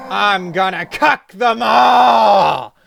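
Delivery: yelled at the top of lungs. Acted. Husband yells his war cry to the world, loud bellow. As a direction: roar it out triumphantly. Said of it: "You yell out loud at the top of your lungs".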